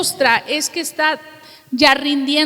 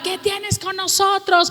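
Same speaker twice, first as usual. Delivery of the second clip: demons shouting; 6 semitones higher than usual. Retold or imitated.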